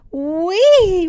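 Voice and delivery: high, loud joyful whee; gleeful cry. Acted. Raggedy Ann after the sodas, gleeful cry. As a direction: squeal it with delight. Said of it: "Raggedy Ann cried".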